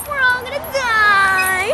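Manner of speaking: high, slow dramatic wail; mock panic. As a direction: ham up the doom on the ride. Scared on spinning ride.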